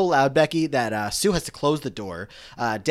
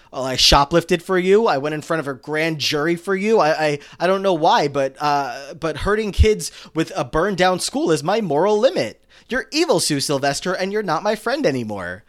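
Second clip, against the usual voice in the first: lightly voiced Becky, higher and louder; hammed-up mock Becky telling Sue off; Becky breaking with Sue, mock upset and loud; imitating Becky; 5 semitones higher, 6 dB louder than usual. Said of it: "Uh, really? Like what?".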